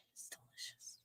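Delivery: hushed unvoiced whisper; whispered delight. Real, not acted. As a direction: breathe it as a secret. Whispered aside about mint.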